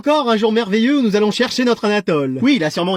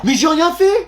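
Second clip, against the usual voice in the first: high-pitched indignant objection; wronged-pupil protest; protesting innocence; 8 semitones higher, 4 dB louder, slower than usual. Acted. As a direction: object in a raised, aggrieved pitch.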